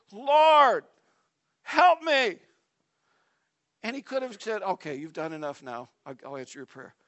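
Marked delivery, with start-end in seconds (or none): 0.1-2.4 higher, louder pleading cry; insistent plea. Acted. Woman kneeling before Jesus, not quitting, insistent plea. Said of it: "She's not gonna quit". 4.6-6.9 soft, low, yielding reply; mild concession. Acted. Preacher imagining Jesus giving in to her prayer, quiet concession.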